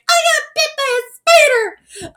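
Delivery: put-on high, loud, drawn-out boyish squawk; Tom Holland as Peter Parker. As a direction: cry it out in an exaggerated, high-pitched superhero voice. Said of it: "that's how I assume Tom Holland talks".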